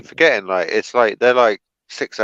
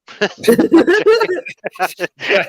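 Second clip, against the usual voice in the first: high burst of laughter; 6 dB louder than usual. Real, not acted. Big laugh after joke.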